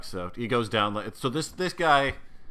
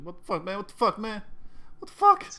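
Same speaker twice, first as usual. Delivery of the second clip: losing it more with each repeat; gibbering panic building to a shriek; 13 semitones higher, 5 dB louder than usual. Acted. Tied-up Fred gibbering at his torturer, rising shriek. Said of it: "He's like".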